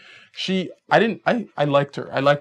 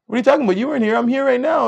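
annoyed date; 9 semitones higher, 5 dB louder, faster than usual. Retold or imitated. Date arguing on phone.

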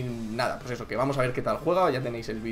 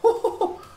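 high burst of delighted laughter; real high laugh; amazed laugh; 4 dB louder than usual. Real, not acted. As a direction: laugh out in disbelief.

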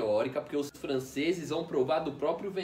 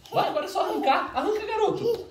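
fast, much higher, laughing urging; excited cheering; 12 semitones higher, 4 dB louder than usual. Real, not acted. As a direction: spur him forward, thrilled. Cheering the kid on to sprint.

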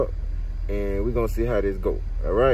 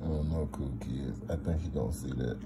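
quiet; 7 semitones lower, 14 dB quieter than usual. Real, not acted. Quietly doubting the prank.

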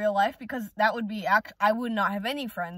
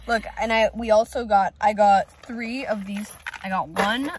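ordinary delivery

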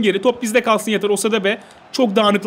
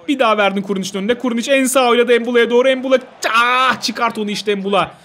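ordinary talk, nothing marked

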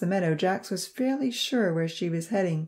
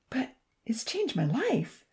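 soft, slow earnest plea; pleading; 7 semitones higher, 5 dB quieter, slower than usual. A narrator's reading. Poncy to Odin, defending his faith, soft slow pleading. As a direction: plead softly and slowly. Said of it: "Poncy pleaded".

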